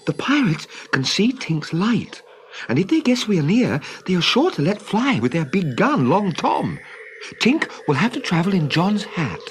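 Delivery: put-on a boy urging his friends to hide the light; boyish Peter. Peter warns the children.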